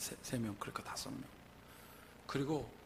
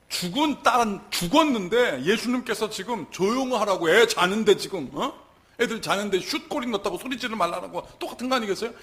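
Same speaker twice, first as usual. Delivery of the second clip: loud, raised indignant argument; impassioned preaching; 7 semitones higher, 12 dB louder than usual. Real, not acted. Preacher likening the hushed mourners to a football night, impassioned. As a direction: drive the comparison home forcefully.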